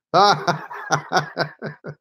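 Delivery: real laugh in a long run; amused laugh. Real, not acted. Streamer cracking up at live chat, amused laugh. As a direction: crack up in the middle of reading.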